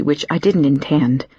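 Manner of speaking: ordinary delivery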